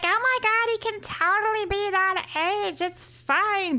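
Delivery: high-pitched imitation of excited fans; mock gushing fans. Host quoting everyone defending the casting, mock gushing.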